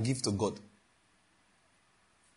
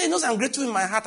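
defensive insistence; 9 semitones higher, 3 dB louder, faster than usual. Retold or imitated. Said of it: "He said".

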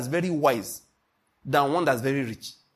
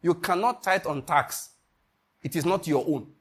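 ordinary talk, nothing marked

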